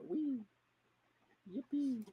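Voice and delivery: high, delighted squeals of glee; gleeful cheer. Acted. A character squealing with glee while flipping him over. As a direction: cheer like a kid on a ride.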